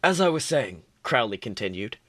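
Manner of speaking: steady read-aloud storytelling voice; story narration. Acted. Reads fan fiction aloud.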